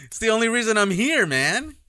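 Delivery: loud, high and fast; excited protest. Real, not acted. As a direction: blurt it out indignantly. Protests loudly.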